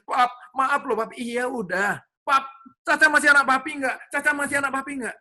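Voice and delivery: a small daughter begging her papa after breaking a glass; pleading little girl. Retold or imitated. Little daughter asks papa if she's still his child, high and pleading.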